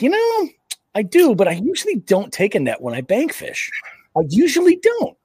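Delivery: high, smug mock voice of his past self.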